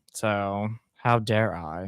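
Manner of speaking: slow, low mock outrage; mock indignation. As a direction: play scandalised at yourself, slowly. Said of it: "watched a couple of movies without you".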